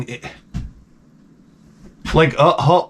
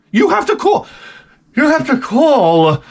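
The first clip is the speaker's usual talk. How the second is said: much higher, slowing on the repeat, nagging wife; mocking wife; 13 semitones higher than usual. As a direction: squeak out the wife's order to phone the restaurant. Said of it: "Oh, I have to call".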